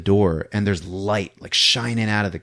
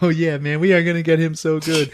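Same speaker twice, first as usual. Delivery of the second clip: hyped-up conspirator; gloating pranksters; 8 semitones higher than usual. Retold or imitated. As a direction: play gleeful friends plotting a prank. Party guests gloat over prank.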